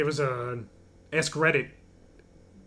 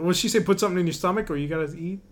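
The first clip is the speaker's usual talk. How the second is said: normal talking voice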